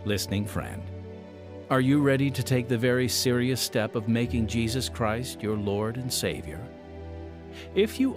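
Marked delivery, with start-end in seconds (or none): none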